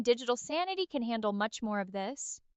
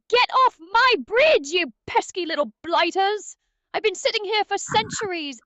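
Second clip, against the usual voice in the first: put-on cranky old British troll; 4 semitones higher, 5 dB louder than usual. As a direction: shout like an ancient bridge troll guarding his bridge. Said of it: "Can you be an even crankier, British, uh, old troll?".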